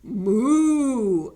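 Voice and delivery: loud, rounded moo; mooing hen. Acted. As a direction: let out a big cow moo. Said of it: "And then hen two said".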